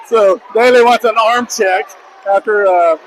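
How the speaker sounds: usual speaking voice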